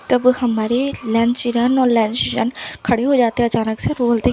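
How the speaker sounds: plain reading voice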